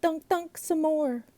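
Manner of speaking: put-on high eager cry of a girl; cried out. A narrator's reading. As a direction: call it out high and pleading. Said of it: "she cried".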